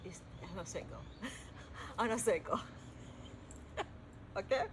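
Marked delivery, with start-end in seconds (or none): none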